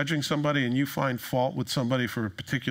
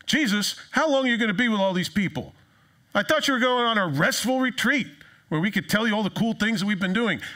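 higher, complaining tired-follower voice; whiny disciples; 6 semitones higher than usual. Acted. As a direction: gripe like a worn-out disciple. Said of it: "This is really what they meant to say".

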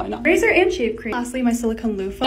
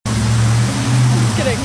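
pitched way down and dragged out slowly; a heavy, slowed joke reveal, lower and louder; slowed-down deep voice; 14 semitones lower, 7 dB louder, much slower than usual. Pretend. Joke reveal after fake Hollywood sign.

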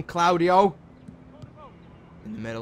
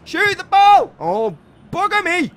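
shout; 13 semitones higher, 10 dB louder than usual. Real, not acted. Yelling at player.

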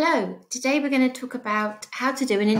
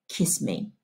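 low leering demand; 10 semitones lower, 8 dB quieter than usual. Acted. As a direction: lower and softer, menacing. Drunk sheriff demands kiss.

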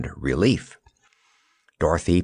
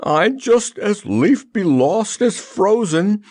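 high, drawn-out plaintive wail; slow, high-pitched lament; 12 semitones higher, slower than usual. A narrator's reading. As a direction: complain pitifully, slowly and high. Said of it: "sneezing plaintively".

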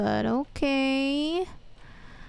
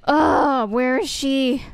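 usual speaking voice

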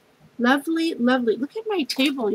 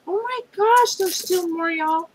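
high squeal of surprise; thrilled surprise at more gifts; excited squeal; 7 semitones higher than usual. Real, not acted. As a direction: squeal because there's still more. Finds more gifts in package.

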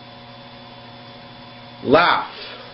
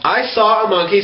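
ordinary talk

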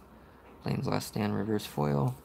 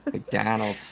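put-on Boston accent; 5 dB quieter than usual. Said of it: "talk like you're from Boston".

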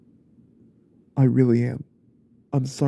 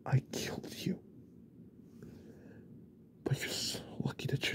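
remorseful whisper; 16 dB quieter than usual. Acted. Apologizing to wife in hospital.

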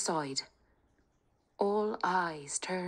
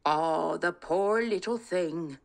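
lightly voiced old father, slow; a gentle Irish da, unhurried; a hint of an old father, slow; kindly old father; 4 dB louder, slower than usual.

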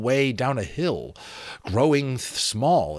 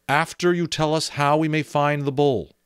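raised, pressing the bargain; magician voice; 6 semitones higher, 4 dB louder than usual. Acted. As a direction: insist on the terms.

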